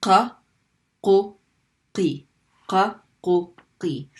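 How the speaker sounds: qa, qu, qi from the back of the throat; Arabic qaf syllables. Shown as a demonstration. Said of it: "So you're gonna practice".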